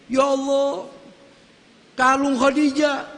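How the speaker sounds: a touch of tearful grief, held back; weeping. A narrator's reading. The Prophet, recognizing Khadijah's necklace, with a touch of tears. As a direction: let the voice catch as if near tears over a keepsake. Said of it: "Nabi nangis".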